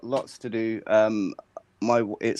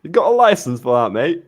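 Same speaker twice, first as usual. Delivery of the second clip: put-on London accent, higher and louder; bad London accent; 5 semitones higher, 5 dB louder than usual. Friend showing off for the group, in a bad London accent. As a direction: lay on a hammy London accent. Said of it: "supposed to be your London accent".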